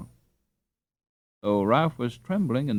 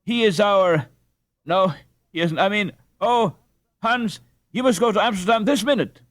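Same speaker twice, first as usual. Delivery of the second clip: higher and louder, hurried and flustered; breathless urgency; 10 semitones higher, 5 dB louder than usual. Acted. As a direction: she gets tangled up in her words, pressing her son to go. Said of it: "panted the dame".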